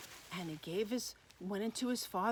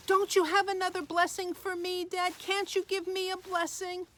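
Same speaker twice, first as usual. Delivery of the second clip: high-pitched, acted wailing plea; hammed-up tearful son; tearful pleading; 10 semitones higher, 4 dB louder than usual. A narrator's reading. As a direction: beg your dad, upset and angry. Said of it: "He started crying".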